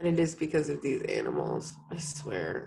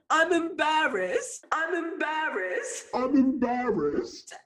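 higher, slow, badly faked British accent; 9 semitones higher, slower than usual. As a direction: blurt it in a ridiculous put-on British accent. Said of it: "See, mine is bad".

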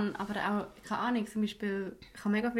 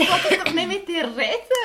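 higher and louder, laughing through it; laughing exasperation; 8 semitones higher, 6 dB louder than usual. Real, not acted. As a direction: laugh while complaining. Exasperated about chatty plane neighbors.

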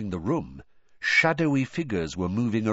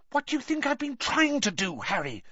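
higher, hushed Hermione; anxious higher hush; girl's whisper. A narrator's reading. Hermione whispers back to Harry, higher, anxious. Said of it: "she whispered back".

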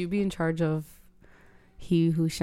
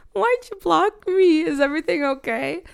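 high worried question; 9 semitones higher, 4 dB louder than usual. Retold or imitated. Retold worried text.

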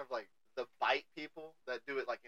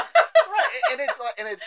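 laughing; 13 dB louder, slower than usual. Real, not acted. Laughing with guest.

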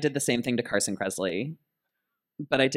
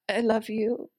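high gushing fan; 10 semitones higher, slower than usual. Retold or imitated. Gushing to idol.

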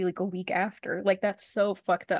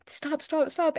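higher-pitched acted pleading; mock panicked plea; 8 semitones higher, slower than usual. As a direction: voice her panic lightly, pitched up. Retold panicked plea to android.

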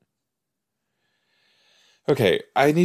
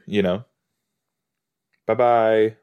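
usual speaking voice

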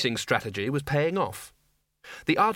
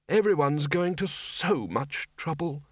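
put-on slow, lingering elderly voice; put-on elder, drawn-out gratitude; grateful old wizard; 3 semitones higher, slower than usual. Undead wizard sitting up, grateful.

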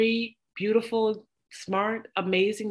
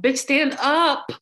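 louder, higher, slow plea to stand up; exasperated urging; 7 semitones higher, 5 dB louder, slower than usual. Retold or imitated. Friend yelling at the awkward character to stand up, exasperated. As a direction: yell it at the screen in exasperation. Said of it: "I'm like".

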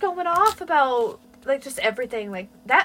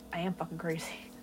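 low murmured menace; 9 semitones lower, 15 dB quieter than usual. Retold or imitated. Odd attendant answering her look, low murmured menace. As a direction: murmur it, dropped right down. Acted deadpan reply of odd coworker.

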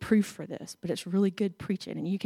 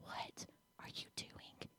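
still small whisper; 24 dB quieter than usual. Acted. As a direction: breathe it like God's gentle whisper. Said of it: "still small whisper of the Lord".

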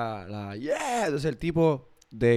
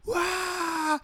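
chillido agudo de fiesta; grito de euforia; 6 dB quieter than usual. Retold or imitated. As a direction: suelta un guau eufórico. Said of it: "Y ella". (chillido eufórico)

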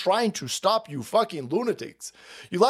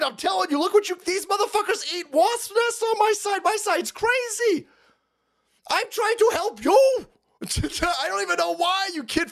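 high, shouted exasperated rant; real furious pleading shout; 10 semitones higher than usual. Real, not acted. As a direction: yell it at them like you've had enough. Ranting at the left, shouting.

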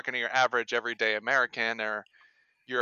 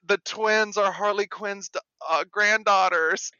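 high astonished reveal; shocked gasp; 8 semitones higher, 7 dB louder than usual. Retold or imitated. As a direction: gasp it out in disbelief. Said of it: "everybody's like".

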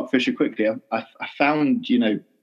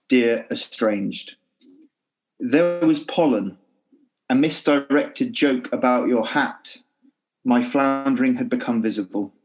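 poetry reading; 2 semitones higher than usual. Acted. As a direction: read it like a poem, unhurried. Reads his poem aloud.

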